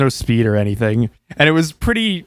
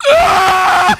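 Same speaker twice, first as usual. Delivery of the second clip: put-on high, loud scream of a scared child; terrified kid scream; 5 dB louder than usual. Kid screams at the roaring T-Rex, high and loud. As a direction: shriek like a kid facing a T-Rex. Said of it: "I'm just like".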